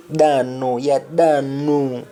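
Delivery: Jamaican patois "Ya da no", drawn out slowly; Jamaican reply. Shown as a demonstration. Teacher repeating Sheila's patois answer, slow. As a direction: stretch "Ya da no" slow and easy. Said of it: "Sheila simply says".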